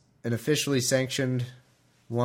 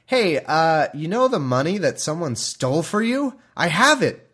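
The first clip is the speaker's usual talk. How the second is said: mock Micah; 5 semitones higher, 4 dB louder than usual. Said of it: "some guy named Micah says".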